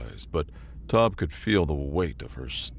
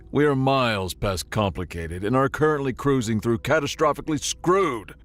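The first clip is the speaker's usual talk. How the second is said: panicked rant; 3 semitones higher than usual. Acted. Tob rants in panic.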